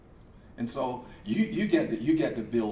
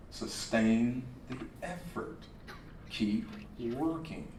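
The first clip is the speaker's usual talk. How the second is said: soft slow urging; 2 semitones lower, 9 dB quieter, slower than usual. Acted. Preacher to crowd, soft slow urging. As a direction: lay it down gently. Quiet closing exhortation.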